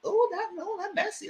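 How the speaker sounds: high teasing "you mad?"; mocking taunt. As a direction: taunt him in a high mock voice. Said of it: "I said".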